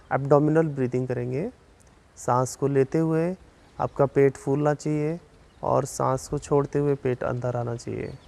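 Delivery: slow, measured relaxation guidance with long pauses; unhurried yoga nidra instructor, slightly softened; guided meditation. Real, not acted. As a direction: guide them calmly through breathing, drawing out counts and cues. Leads a yoga nidra session.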